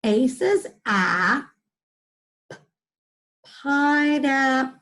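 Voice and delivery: teacher blending the word; slow sounding out. Shown as a demonstration. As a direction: stretch out A says a, p-, pineapple. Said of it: "let me look at the rest".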